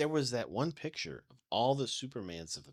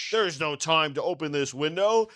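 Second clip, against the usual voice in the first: mock heroic line; 4 semitones higher, 7 dB louder than usual. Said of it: "where he's like".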